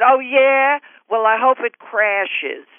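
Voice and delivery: high snap, then slow and nasty; bitter fan's slow jab; spiteful retort. Retold or imitated. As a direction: spit out a curse on her plane. Fan turns spiteful, retold.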